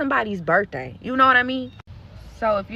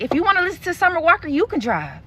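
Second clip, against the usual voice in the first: fast, higher mocking snap; snippy fast retort; 8 semitones higher, faster than usual. Driver girl snapping at the birthday girl, fast and high. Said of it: "The girl like".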